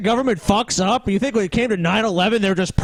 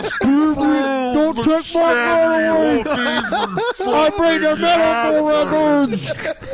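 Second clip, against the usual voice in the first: mock parent voice; 4 semitones higher, much slower than usual.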